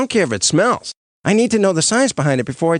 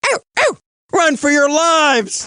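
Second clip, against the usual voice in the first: panicked scream; 11 semitones higher than usual. Acted. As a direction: yell it in terror, fleeing. Panicked fleeing after being hurt.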